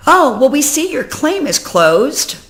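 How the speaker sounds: mock breezy agent. Quoting the agent's email to viewers, mock breezy. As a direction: read the email like it's nothing. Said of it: "I get an email saying".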